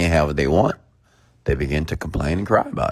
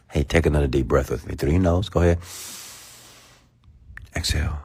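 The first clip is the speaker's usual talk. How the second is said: guided breathing. Acted. Leads a breathing exercise.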